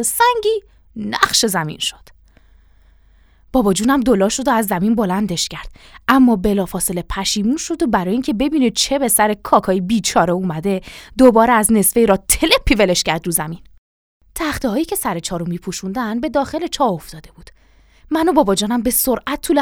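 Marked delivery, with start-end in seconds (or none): none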